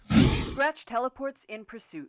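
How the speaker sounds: loud, slow dramatic narration; narrator, dramatic. Acted. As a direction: announce the chase with weight. Narrator action line.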